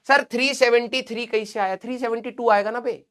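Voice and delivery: ordinary talk, nothing marked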